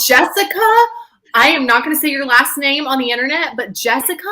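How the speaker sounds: a playful scolding cry of disbelief; mock outrage. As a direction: act scandalized by her friend's words.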